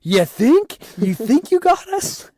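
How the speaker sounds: high, loud retold comeback, pitch climbing; mock incredulous retort. Scared visitor answering the clown who jumped out, mock incredulous. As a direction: throw it back with exaggerated, squeaky disbelief.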